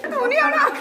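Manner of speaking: loud high call across the room; calling out loudly. Acted. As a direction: shout it to someone far off. Aunty calls out to Sahil.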